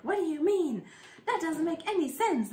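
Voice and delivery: put-on a frustrated little kid objecting; upset child. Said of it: "I'd get mad and I'd be like".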